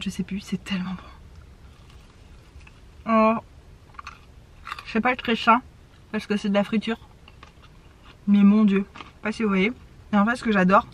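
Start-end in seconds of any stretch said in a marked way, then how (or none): 0.0-3.1 whisper. Real, not acted. Savouring the food. 3.1-9.7 talking with mouth full. Real, not acted. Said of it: "désolée je parle à bouche pleine".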